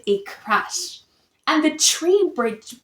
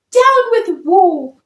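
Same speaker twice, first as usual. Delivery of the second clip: higher, much louder hoot; hooting cry; 6 semitones higher, 8 dB louder than usual. A narrator's reading. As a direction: hoot it out proudly. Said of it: "And he hooted".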